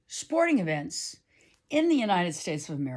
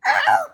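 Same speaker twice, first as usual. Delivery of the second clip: sudden low loud yelp; imitated horse noise; 9 semitones lower, 9 dB louder than usual. Rider mimicking the horse's sudden lurch, low loud yelp. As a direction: bark out the horse's jolt as a noise. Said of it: "she did this thing like".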